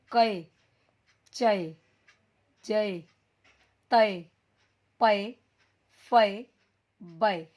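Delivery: कै, चै, तै, पै, फै each said loud and apart; ai syllables drilled. Shown as a demonstration. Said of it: "अब इसको बोलेंगे कैसे देखो". (हर ऐ वाला अक्षर ज़ोर से अलग अलग)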